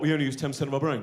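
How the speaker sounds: fast, breezy know-it-all parroting a myth; mocking know-it-all. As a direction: rattle it off like a smug pub fact. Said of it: "when people go".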